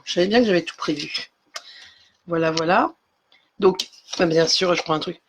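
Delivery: ordinary talk, nothing marked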